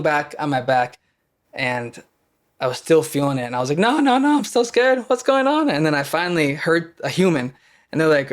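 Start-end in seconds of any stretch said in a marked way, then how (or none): 3.8-5.7 high, loud frightened cry, much higher; retold panic. To the empty street, mid panic attack, a high loud frightened cry. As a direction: yelp it out like a scared kid in the snow.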